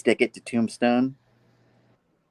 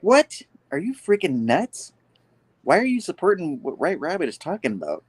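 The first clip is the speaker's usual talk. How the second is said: high-pitched retold disbelief; mock-outraged questioning; incredulous protest; 8 semitones higher than usual. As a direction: jump up on a shocked question. Retold Peter's disbelief at the plan.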